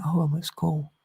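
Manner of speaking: high, startled snap back to reality; startled realisation. Retold or imitated. Waking from a childhood daydream in class, startled and high. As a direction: jolt awake from the daydream.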